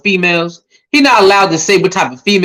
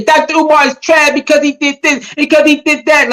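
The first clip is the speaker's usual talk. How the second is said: higher-pitched mock complaint; mocking critics; 6 semitones higher than usual. Critics griping online about Doctor Umar, mocking imitation. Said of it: "trying to say".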